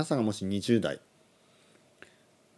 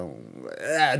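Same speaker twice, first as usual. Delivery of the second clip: low, drawn-out indecisive groan; mock groan; 6 semitones lower, much slower than usual. Mimicking Terrace House cast dithering, low mock groan. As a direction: imitate a muddled, low groan. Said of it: "ってなりますね".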